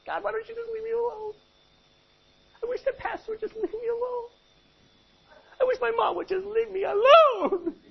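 high, whining plea to be left alone; mock a sulky believer; high whiny leave-me-alone voice; whiny complainer. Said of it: "You ever felt like that?".

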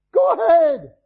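high, loud challenge; defiant retort. Real, not acted. Preacher answering the defiant listener, loud dare. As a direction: fling it back at them.

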